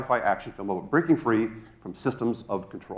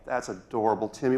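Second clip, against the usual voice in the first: usual speaking voice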